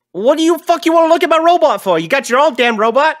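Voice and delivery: high, loud and fast dwarf tirade; tiny dwarf ranting fast at top volume; furious dwarf rant. Acted. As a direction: spit it out, outraged and quick. Dwarf rants about his robot.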